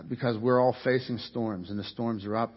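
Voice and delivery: usual speaking voice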